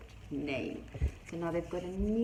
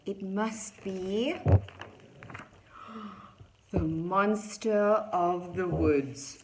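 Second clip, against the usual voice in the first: slow, drawn-out reveal, building louder; dramatic dread; 7 dB louder, slower than usual. A narrator's reading. The friends guess it is the monster, dramatic dread. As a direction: stretch out each word ominously. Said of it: "they all whispered together".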